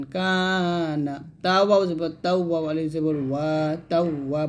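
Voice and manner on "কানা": কানা with the first "aa" held long; কানা drawn out on its first syllable; lengthened vowel. Shown as a demonstration. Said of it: "এক লিফ টান".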